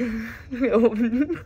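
short rising laugh of disbelief; disbelieving laugh. Real, not acted. Hearing the van won't start, a disbelieving laugh. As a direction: laugh like it must be a joke.

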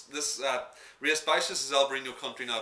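ordinary delivery

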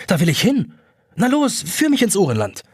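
Jonas begs to be led into Uhrenland, pitch climbing excitedly. Acted. Pitch climbing, eager to go.

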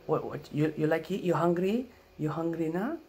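high, loud broken-English offer; Filipino grandma accent. Retold or imitated. Grandma offering food in the morning, in a Filipino accent.

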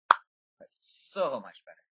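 SO stretched long and loud; emphatic. Real, not acted. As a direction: lean hard on "so", drawing it out slowly. Relief that lagging has improved.

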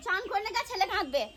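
ordinary talk, nothing marked

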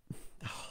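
real, voiceless sigh of frustration; exasperated sigh. Real, not acted. Narrator fluffing a line while recording, real soft sigh. Narrator sighs at a misread name.

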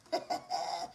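low, quiet chuckle; soft breath of a laugh; taunting chuckle. Real, not acted. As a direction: laugh softly and low to yourself. Laughs after taunting rivals.

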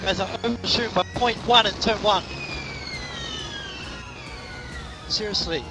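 real exasperation at the engineers; exasperated complaint. Real, not acted. Driver complains about bad information.

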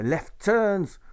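high, loud parade-ground shout; shouted drill command. Acted. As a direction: yell the order across the drill field. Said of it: "He calls for".

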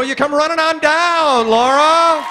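rising to a high, loud name call; contestant call. Shown as a demonstration. Warm-up man calling Laura to contestants' row, loud soaring call. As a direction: demonstrate the big announcer summons.

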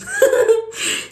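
loud, high burst of laughter; high giggle. Real, not acted. Delighted the Holy Spirit helps her, high giggle. Amused while praising the Spirit.

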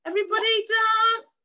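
loud, high cheer to get everyone dancing; calling out. Real, not acted. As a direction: shout it out like a party host. Calling the kids to dance.

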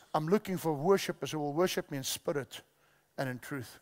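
soft, low voice, sinking to a hush; gentle quoted Jesus. Retold or imitated. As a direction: quietly voice Jesus, letting it fade. Preacher voices Jesus on worship.

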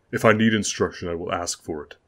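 sharp, haughty and loud; cold rebuke. Acted. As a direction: put the servant in his place. Lord rebukes Will.

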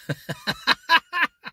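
a short high laugh, falling away. Real, not acted. Preacher laughing at the customer's blunt question, high laugh.

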